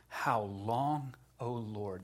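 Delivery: low, soft plea; subdued cry to God. Retold or imitated. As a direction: quote it quietly, like a weary prayer. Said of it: "It's the same cry that David uttered".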